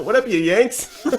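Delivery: high, slow, laughing "what up" to the Yanks; laughing mock greeting. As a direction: greet the Americans through a laugh. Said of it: "was probably just like".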